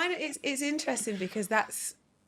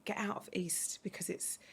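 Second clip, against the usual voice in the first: whispered; 8 dB quieter than usual. Retold or imitated. Hushed warning about the East End.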